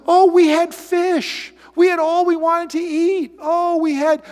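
high, slow wailing lament; hammed-up Israelites moaning about Egypt; mock whining. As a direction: whine about the fish like a crybaby.

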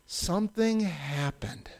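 slow, whispered cliffhanger; hushed suspense. Acted. Storyteller revealing Moses's thirty-ninth year, hushed suspense. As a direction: drop to an ominous whisper.

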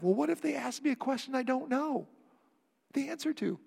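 lightly imitated anxious questioner; worried believer. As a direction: lightly voice someone fretting over a question.

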